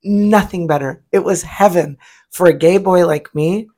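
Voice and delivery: usual speaking voice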